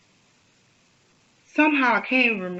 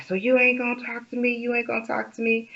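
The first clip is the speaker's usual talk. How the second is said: retold quote; 6 semitones higher, 4 dB quieter, faster than usual. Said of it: "he's like".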